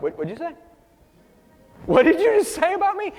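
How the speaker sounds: imagine being offended by a jab; mock-offended "what did you say?"; indignant comeback. [name]'s imagined indignant reaction.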